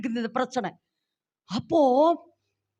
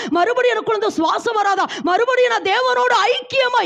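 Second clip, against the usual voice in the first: building desperate cry; 5 semitones higher than usual. Acted. Preacher voicing Eve weeping for Eden, building to a cry. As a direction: let Eve's longing rise until she is crying out. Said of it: "ஏவாள் அழுதாளா".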